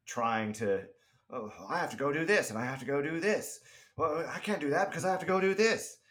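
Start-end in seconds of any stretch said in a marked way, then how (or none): 1.3-6.0 frantic busy man. Retold or imitated. Mocking David's frantic excuses.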